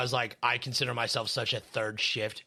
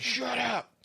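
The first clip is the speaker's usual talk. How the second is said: high mock yell; 11 semitones higher than usual.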